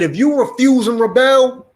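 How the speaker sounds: oppressor's threat. Retold or imitated. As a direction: boom out the master's warning. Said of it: "He said".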